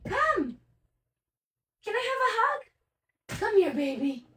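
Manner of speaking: high sing-song dog call. Real, not acted. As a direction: coax the puppy over sweetly. Coaxing the dog over.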